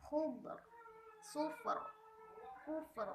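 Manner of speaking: Khubr, sufr, kufr with the r thick and heavy. Shown as a demonstration. Teacher demonstrating thick r to students. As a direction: pronounce the r in kufr full and heavy. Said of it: "Harus tebal".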